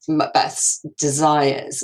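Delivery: usual speaking voice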